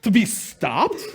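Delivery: emphatic, exasperated complaint rising to a question; you can't be serious; incredulous disbelief. Acted. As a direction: act out outraged disbelief, drawing out the end. Israelites' acted disbelief at the sea.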